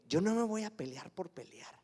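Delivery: soft whispered vow to himself; whispered resolve. Retold or imitated. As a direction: breathe it almost unvoiced. Decisión interior recordada.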